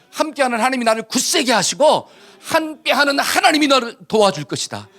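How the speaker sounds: emphatic, driving scripture declaration; rally the congregation with rising insistence; preaching at full force. Acted. Preacher declaring God's help to the congregation, forceful and ringing.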